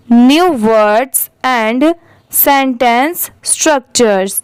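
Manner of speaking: very slow, word-by-word model reading; repeat-after-me reading. Shown as a demonstration. Teacher reads paragraph for learners to repeat, slow word-by-word dictation. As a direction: stretch each word out and pause for the learner. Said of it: "आप भी मेरे बाद repeat करिए".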